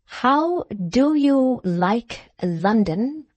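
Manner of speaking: slow, over-careful pompous host; talking to a deaf child. A narrator's reading. Host questioning a foreign guest, slow and patronising. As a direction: dose out each word like medicine. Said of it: "as if he were administering something ... to the deaf child".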